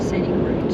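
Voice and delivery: route said like root, rhymes with boot; route with a long oo vowel; American route. Shown as a demonstration. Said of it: "Don't Americans say route?".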